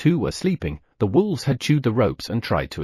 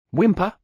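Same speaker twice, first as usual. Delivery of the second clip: wolf whimper; 8 semitones higher than usual. Acted. Wolves whimpering.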